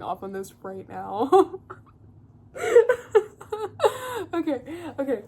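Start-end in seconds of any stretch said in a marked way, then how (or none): 2.5-5.2 high laughing fit, trying to recover; real laughter fading into okay. Real, not acted. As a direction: laugh it out then settle. Cracking up at herself.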